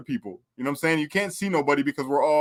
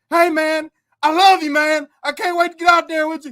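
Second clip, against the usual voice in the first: high, loud fond farewell; as Bullfrog; 11 semitones higher, 5 dB louder than usual. Retold or imitated. Imitating Bullfrog saying goodbye to the yard inmates, high and loud. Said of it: "I'm like".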